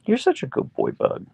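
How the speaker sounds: high, slow sing-song coo. Real, not acted. Owner cooing at her husky, high baby talk.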